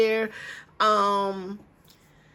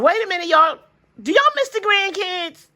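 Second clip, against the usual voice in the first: sudden high, louder excited question; excited call; 9 semitones higher, 4 dB louder than usual. Real, not acted. Grandma calling out to her viewers, suddenly excited.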